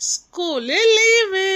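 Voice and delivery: high, loud and stretched out; slow, gleeful holiday proclamation; big happy announcement. Acted. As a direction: reveal great news to a class.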